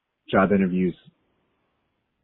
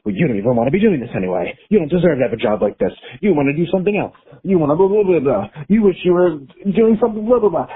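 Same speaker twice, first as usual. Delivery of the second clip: fast, rising, louder mock nag of her own ego; nagging inner voice; 6 semitones higher, 4 dB louder, faster than usual. Imitating the voice of her anxious ego, growing louder and higher. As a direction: rattle it off like a scornful inner critic. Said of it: "my ego pops up, um, and is like".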